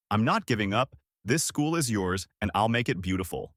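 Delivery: ordinary delivery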